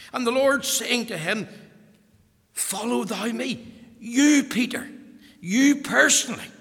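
loud, high, driving sermon voice; preaching at full force. Acted. Preacher urging Peter to follow Christ, full-force preaching. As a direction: preach it hard, pressing the point home.

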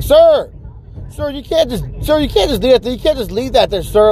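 high-pitched, flustered manager impression; mocking high manager voice; protesting store manager. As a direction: plead with a departing customer. Acts manager trying to stop him.

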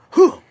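high sharp martial arts yell; kung fu shout. Pretend. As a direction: let out a fighting cry. Kung fu cry.

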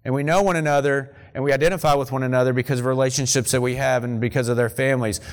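ordinary delivery

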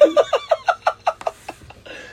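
cracking up at his own story; real burst of laughter. Real, not acted. Laughing at the falling pants.

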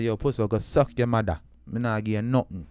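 light Jamaican accent, mocking brush-off. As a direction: toss it off in a casual Jamaican accent. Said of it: "and my Jamaican accent".

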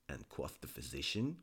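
ordinary delivery, nothing marked

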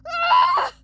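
high, loud scream of pain; agonised shriek. A narrator's reading. Blacksmith as his broken arm twists, shrieking. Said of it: "He shrieked".

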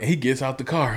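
ordinary talk

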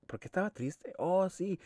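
high, puzzled self-question; puzzled inner voice. Retold or imitated. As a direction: wonder aloud, confused. Retelling own confused thought.

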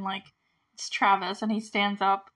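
ordinary delivery

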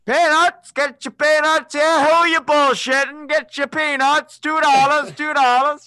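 loud, high, slow vendor call; stadium vendor hawking. Retold or imitated. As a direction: bellow it like a peanut seller in the stands. Imitating ballpark peanut vendor.

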